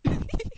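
loud high burst of laughter; real delighted laugh. Real, not acted. Tickled by own accent imitation.

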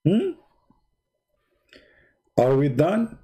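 ordinary talk

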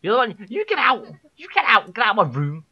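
high-pitched ranting order to leave; mock angry yelling. Streamer orders child out of the room, mock angry yell. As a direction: screech it in mock outrage.

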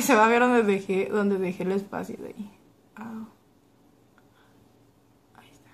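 talking while peeling lip film; fingers on lips. Real, not acted. Seen 3: fingertips pinch lower lip corner while she talks.

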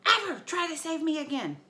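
high, loud furious scream; angry warrior refusing rescue. Acted. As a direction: scream a warning at him. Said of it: "Clarice screamed".

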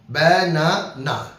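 slow banana, broken into its three syllables; slow teacher demo of banana in three parts; split into syllables. Shown as a demonstration. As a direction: draw banana out slowly, syllable by syllable. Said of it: "তিনটা আছে".

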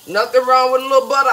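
ordinary talk, nothing marked

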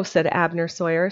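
ordinary delivery, nothing marked

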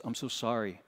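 low, soft, grave news; somber doctor. Retold or imitated. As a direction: deliver it like a solemn doctor, quickly. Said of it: "really somber".

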